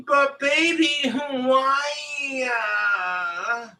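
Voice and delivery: high, drawn-out whiny plea; whining. Acted. Girlfriend pleading with boyfriend, exaggerated high whine. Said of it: "Don't whine at me".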